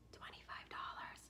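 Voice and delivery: unvoiced secret whisper of the price. Whispered price reveal, then repeated.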